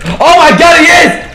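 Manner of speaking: very high, loud alarmed shout; shocked yell. Real, not acted. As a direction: yell it as he appears. Spots enemy with revolver.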